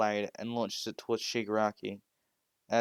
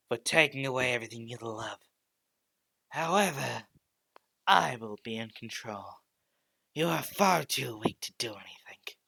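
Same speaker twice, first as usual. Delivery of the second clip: sinister whisper; 3 dB louder than usual. Acted. Dark entity bargains for control.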